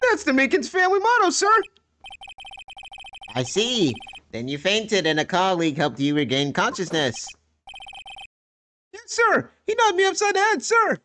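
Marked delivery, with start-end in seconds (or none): 0.0-1.5 much higher, eager soldierly voice; high-pitched dutiful policeman saluting every line; eager rookie cop. Acted. Meekins motto. 8.9-11.0 much higher, eager soldierly voice; high-pitched dutiful policeman saluting every line; eager rookie cop. Acted. Meekins answers.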